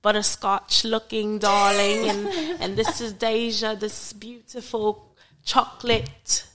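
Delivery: hammed-up British podcast host; British accent. Co-host introducing friends and thanking listeners, British accent. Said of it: "close out in your British accent".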